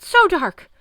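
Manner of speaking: high, loud terrified yell; panicked shout; frightened yell. A narrator's reading. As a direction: beg against the dark. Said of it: "Chris yelled".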